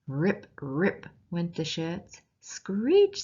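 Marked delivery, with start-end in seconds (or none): 2.4-3.2 high, loud animal screech; sharp high possum screech. Acted. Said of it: "screech went the possum".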